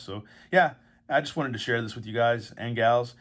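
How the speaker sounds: ordinary talk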